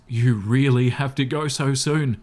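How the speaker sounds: slow, wobbling plea, voice trembling; acted quiver of a disappointed suitor; quavering voice. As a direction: let the voice shake on a slow sulky question. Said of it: "he asked in a quavering voice".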